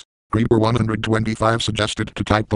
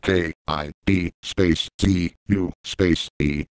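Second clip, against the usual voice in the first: slow spelled-out letters; 4 semitones lower, much slower than usual. Shown as a demonstration. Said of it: "To fix this the normal way".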